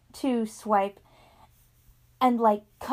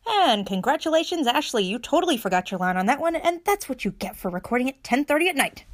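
ordinary talk, nothing marked

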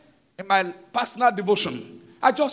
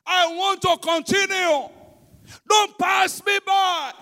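crying out; 8 semitones higher, slower than usual. Retold or imitated. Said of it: "He cried out".

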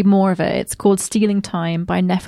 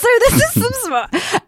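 higher, giggling through it; giggly announcement; 12 semitones higher than usual. Shown as a demonstration. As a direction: laugh as you announce the smut. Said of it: "repeated with a different delivery".

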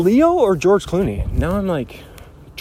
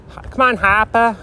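higher-pitched imitation of an ad catchphrase; commercial imitation; 5 semitones higher than usual. Host recalling an old commercial, lightly imitated catchphrase. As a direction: lightly mimic a commercial character's plea. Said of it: "Wasn't it, like, a commercial".

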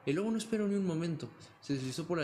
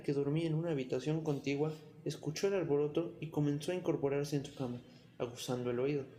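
ordinary delivery

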